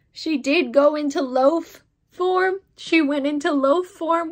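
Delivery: gushing over the cat's loaf; delighted squeal. Real, not acted. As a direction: savour the cute news. Excited cat progress news.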